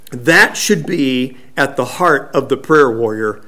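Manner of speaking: normal talking voice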